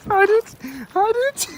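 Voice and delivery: very high laughing squeal; shrieking laughter. Real, not acted. Laughing while hiding.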